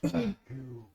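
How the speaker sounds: soft, halting creature thanks; grateful Bob. Acted. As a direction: thank them quietly, as a gentle monster. Game master plays Bob thanking the party.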